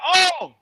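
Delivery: put-on boy groaning. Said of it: "He like".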